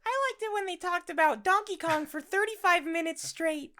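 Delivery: high, put-on fan voice; Margaret voice. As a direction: answer as a gushing listener, up high. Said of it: "hey, Margaret, what was your favorite part".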